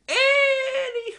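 very high, loud, slow sing-out; drawn-out holler. Pretend. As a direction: belt it out to drag the talk back. Loud jokey return to topic.

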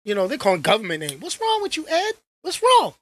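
high, needling mock voice; mocking fans. Fans mocking the vlogger, needling and high. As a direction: tease him like nosy fans. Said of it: "people are gonna be like".

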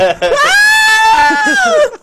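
very high, loud laugh; real high-pitched cackle; shrieking laugh. Real, not acted. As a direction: burst into a long screech of laughter. Reacting to the absurd story.

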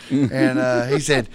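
ordinary delivery

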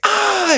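very high, loud startled shriek; shocked scream. Retold or imitated. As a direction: shriek as if walking in on something. Meg sees Quagmire having sex.

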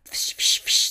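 bursts of hissed "fsh"; imitated speaker static. Storyteller imitating old hospital-style loudspeakers, static noise. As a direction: imitate old loudspeaker crackle with sharp shushes. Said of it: "old loudspeaker systems would always have that".

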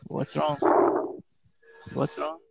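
ordinary delivery

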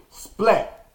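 a sharp, high "Splat" thrown out as the fall's sound effect; mock splat. Telling listeners how he hit the ground, a high punchy "Splat". Imitating his fall from the tree.

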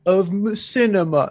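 mock the gushing British reviewer in a lifted voice; British critic. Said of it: "She's like".

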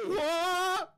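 very high, loud startled yelp; shocked cry. Acted. Dipper startled as Mabel runs over him, very loud and high.

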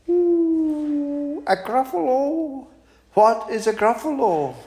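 very high, hooting owl, sly and sweet; a sugary high owl inviting the mouse to tea. Acted. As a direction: coo like a crafty old owl luring its dinner. Owl asks.

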